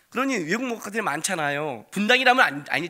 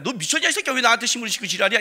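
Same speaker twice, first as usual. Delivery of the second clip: fast, loud fuming rant; father wanting to scold his son; angry outburst; 4 semitones higher, 4 dB louder, much faster than usual. Retold or imitated. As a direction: tear into him at speed. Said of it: "이래야 되는데".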